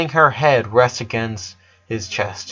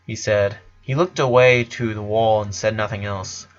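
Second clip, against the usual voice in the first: ordinary delivery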